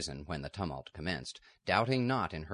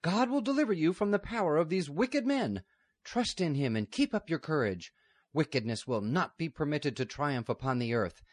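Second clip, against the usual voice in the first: much higher, louder fervent blessing; fervent abbess; 7 semitones higher, 4 dB louder than usual. Acted. Abbess blessing the captured girl, high and fervent. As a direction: pour faith into her as she is taken.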